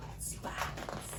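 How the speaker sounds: put-on hushed suspense whisper to the kids. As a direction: whisper it as if not to wake the bear. Suspense aside to children.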